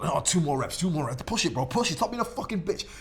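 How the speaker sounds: rally your partner through the set; gym bro shouting. Retold or imitated. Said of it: "The guys who are shouting at each other".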